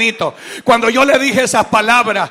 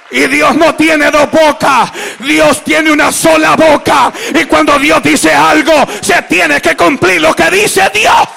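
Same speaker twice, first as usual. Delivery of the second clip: prédica a pleno pulmón; 5 semitones higher, 7 dB louder than usual. Real, not acted. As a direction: proclaim it to the congregation at full force. Preaches at full force. (predicación exaltada, gritada y aguda)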